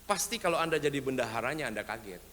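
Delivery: ordinary talk, nothing marked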